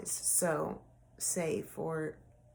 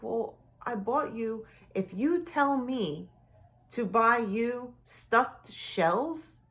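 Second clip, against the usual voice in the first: higher, slower, pleading protest; defensive protest; 5 semitones higher, 4 dB louder, slower than usual. Retold or imitated. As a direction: retell defending herself, a bit exasperated. Retelling argument with ex-husband.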